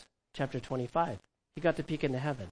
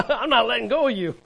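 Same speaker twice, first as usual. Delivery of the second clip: higher, louder laughing plea; clingy, laughing; 7 semitones higher, 7 dB louder than usual. Pretend. Mary clinging to Jesus, laughing and louder. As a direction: laugh as you hold on tight. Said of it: "She felt like".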